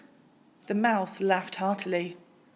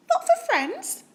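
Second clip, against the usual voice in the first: much higher squeaky mouse; a small bright-eyed mouse piping up; tiny mouse voice; 4 dB louder than usual. Acted. Polite mouse asks Bear for cheese, squeaky high.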